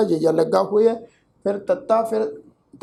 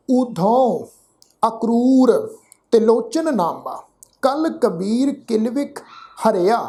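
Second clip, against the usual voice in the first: slow, measured recitation with pauses between phrases; scripture recitation; much slower than usual. Shown as a demonstration. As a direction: recite each word deliberately, vowels drawn out. (ਠਹਿਰ ਠਹਿਰ ਕੇ ਗੁਰਬਾਣੀ ਪਾਠ)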